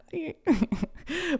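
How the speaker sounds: high amused giggle; short real giggle; light laugh. Real, not acted. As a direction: giggle at the joke. Laughing at own joke.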